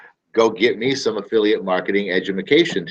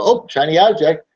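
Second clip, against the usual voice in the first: distracted customer; 8 semitones higher, 4 dB louder than usual. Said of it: "they're gonna go".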